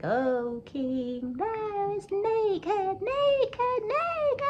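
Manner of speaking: put-on very high, loud child's taunt; slow sing-song climbing to a piercing squeal. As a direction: call out like a teasing child, stretched and high. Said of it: "he heard a child speak".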